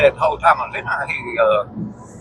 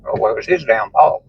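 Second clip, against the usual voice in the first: normal talking voice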